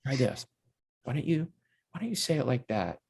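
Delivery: soft, sly coaxing with a little grin; hushed imitation of a director's suggestion; Toby. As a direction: nudge gently, keeping it quiet. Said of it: "having this funny little grin".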